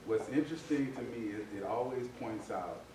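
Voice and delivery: ordinary talk, nothing marked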